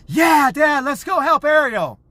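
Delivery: put-on very high, loud child's voice; imitated eager daughter. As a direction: squeal an eager yes as the excited kid. Acts daughter's eager reply.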